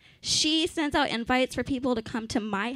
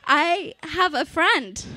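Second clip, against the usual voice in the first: excited; 5 semitones higher, 6 dB louder, slower than usual. Real, not acted. Thrilled at having a friend.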